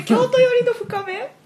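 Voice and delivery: surprised question. Real, not acted. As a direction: bounce it back in disbelief. Startled by "deep Kansai dialect. (驚いて聞き返す)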